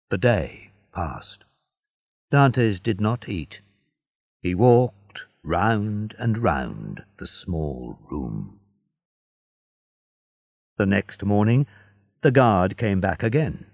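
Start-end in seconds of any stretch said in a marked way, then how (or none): none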